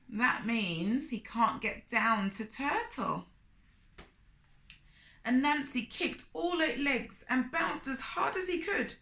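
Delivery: plain reading voice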